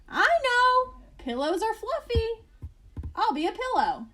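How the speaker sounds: put-on high and loud, eager child; excited idea. Fluffy the porcupine excitedly deciding to be a pillow. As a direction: burst out with the new idea.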